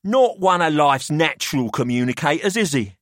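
put-on a wry businessman weighing up the boys; Pelham's voice.

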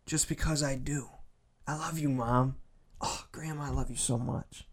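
soft, hushed loving words; tender affection. Acted. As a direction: say it gently, almost under your breath. Voicing tender words to family.

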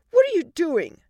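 high, loud indignant question; snapped. Acted. Wife catching husband twisting daughter's arm, snapping. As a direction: demand it sharply, alarmed. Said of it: "Allie snapped".

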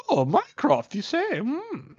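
a hint of a creepy old lady, rising and fading; creepy old mistress. Headmistress eyeing the box from Mycroft, lightly creepy and suspicious. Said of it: "the old creepy mistress lady".

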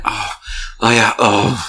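imitating a turned-on caller; lightly acted phone-sex moan; mock sensual moaning. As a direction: coo it as a mock swoon. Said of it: "sounded like phone sex on her end".

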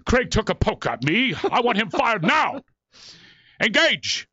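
high, mock-outraged star actor; Patrick Stewart. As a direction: tattle like a hammed-up British thespian demanding action. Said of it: "he would tell on me".

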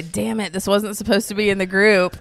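loud, exasperated mock quote; mock his loud exasperation. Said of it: "how many times you say".

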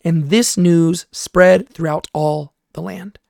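slow, deliberate scripture phrase; slow emphasis. Shown as a demonstration. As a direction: lean on every word slowly. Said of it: "I want you to notice this phrase".

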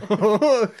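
high, loud mock-Irish "oi"; Irish accent attempt. Friend trading vowels, loud Irish accent attempt. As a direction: echo a hammed-up Irish call. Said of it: "tried to talk in an Irish accent".

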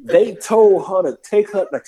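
normal talking voice

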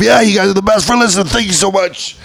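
high, loud, cheery thanks; upbeat. Shown as a demonstration. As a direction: sound thrilled to thank them. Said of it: "If you sound up".

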